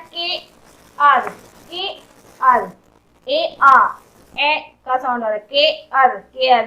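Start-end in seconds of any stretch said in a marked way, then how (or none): 0.1-2.7 "के आर" in two separate beats; sounding out. Shown as a demonstration. Teacher asking which sound comes, sounding out "के आर". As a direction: split "के" from "आर", pausing between them. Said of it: "इसमें क्या sound आ रहा है".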